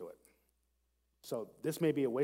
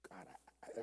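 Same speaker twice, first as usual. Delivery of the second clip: breathy whisper to God; whispered prayer; 6 semitones lower, 17 dB quieter than usual. Acted. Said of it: "read this slowly and prayerfully".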